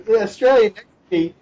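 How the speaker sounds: ordinary delivery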